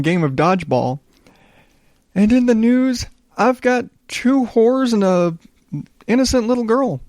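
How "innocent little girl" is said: ordinary talk, nothing marked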